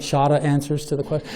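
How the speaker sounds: shorter said Boston style as "shahtah", no r sounds; a playful, light Boston accent on "shorter answers". Shown as a demonstration. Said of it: "That was my Boston accent".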